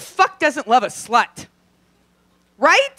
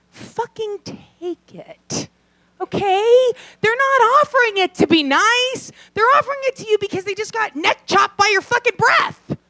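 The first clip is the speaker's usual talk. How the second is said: pitched up and fed up, scolding the crowd; exasperated rant; 10 semitones higher, 3 dB louder than usual. Real, not acted. Comic scolding the audience about bad breath, pitched up and exasperated. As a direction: lay into them, half yelling and half laughing. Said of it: "rant at bad-breath people".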